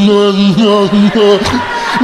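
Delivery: imitated sticky-mouthed munching; horse chewing noise. Horse with peanut butter in its mouth, mock chewing. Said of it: "put peanut butter in its mouth".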